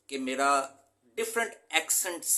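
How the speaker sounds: normal talking voice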